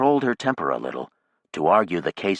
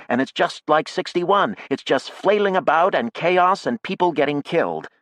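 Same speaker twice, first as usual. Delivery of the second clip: raised, higher insistent voice; heated argument; 7 semitones higher, 3 dB louder than usual. A narrator's reading. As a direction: argue hard, holding temper barely. Maya argues hotly.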